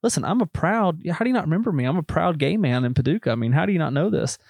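indignant caller with swooping pitch; Teddy McIntosh. Retold or imitated. Prank caller demanding salesman remember him, put-on indignant accent. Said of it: "I'm doing it in my whole accent".

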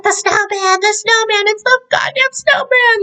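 a shopkeeper losing his mind over the snowman; freaking out. Retold or imitated. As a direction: babble it in rising hysteria. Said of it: "like, freaking out".

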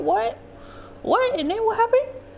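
very high, rising eager questions; excited mother. Retold or imitated. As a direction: imitate a mother hungry for exciting news. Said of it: "She was looking for the excitement".

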